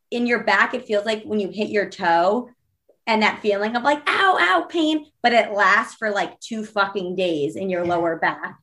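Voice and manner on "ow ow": high yelping ow ow; quick high mock ow cries; mock pained yelps. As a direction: yelp like you stubbed a toe. Imitating toe-stub pain.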